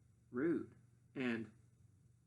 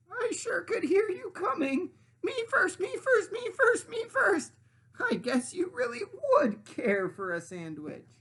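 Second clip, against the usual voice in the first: cackling witch; 7 dB louder than usual. Acted. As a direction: cackle it at the startled pig. Witch mimics the pig's cries.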